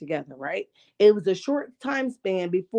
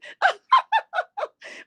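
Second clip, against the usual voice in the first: high laugh. Real, not acted. Big laugh.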